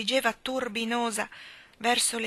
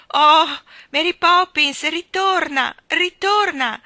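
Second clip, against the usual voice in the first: put-on high, loud children's cry; pleading shout; 9 semitones higher, 14 dB louder, slower than usual. A narrator's reading. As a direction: call her back at full voice. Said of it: "gridarono i ragazzi".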